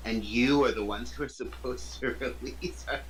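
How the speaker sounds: she-devil laughing at the mortals; amused laugh. Acted. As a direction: laugh at their plight.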